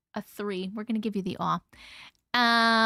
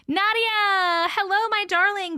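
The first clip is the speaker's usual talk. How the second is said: fond greeting; 7 semitones higher, 8 dB louder, slower than usual. Real, not acted. Greeting a viewer fondly.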